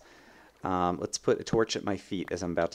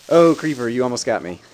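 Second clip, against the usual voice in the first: loud, raised voice after a scare; shaken exclamation; 10 dB louder than usual. Real, not acted. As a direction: call out the creeper after a near miss. Relief after near miss.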